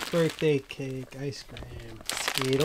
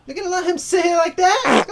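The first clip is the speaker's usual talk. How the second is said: high nagging voice; 5 dB louder than usual. Acted. Swapped roles, nagging partner.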